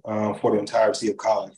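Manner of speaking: ordinary talk, nothing marked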